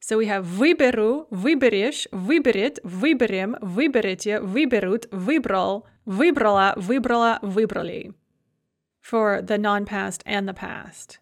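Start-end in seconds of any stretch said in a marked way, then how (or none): 0.4-8.1 every form from wyberu to wybrali stressed on its first syllable; stress on the prefix. Shown as a demonstration. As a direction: recite the forms, hitting the opening vy in wyberu, wyberiesz and each one after. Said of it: "the stress remains fixed on the vy".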